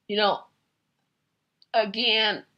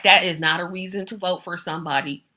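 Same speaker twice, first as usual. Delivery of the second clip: ordinary talk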